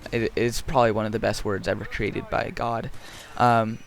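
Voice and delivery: ordinary talk, nothing marked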